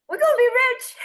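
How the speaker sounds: high, loud burst of glee; retold excitement. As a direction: exclaim it like a midnight brainwave, imitating yourself. Retelling own excited midnight plan.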